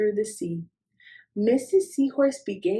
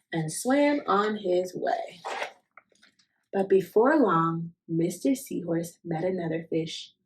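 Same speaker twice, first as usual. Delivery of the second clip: plain reading voice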